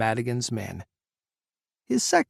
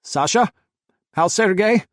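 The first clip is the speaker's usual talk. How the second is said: loud, higher calling out; shouted; 7 semitones higher, 7 dB louder than usual. A narrator's reading. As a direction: shout it toward the cockpit. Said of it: "Jack shouted toward the silent cockpit".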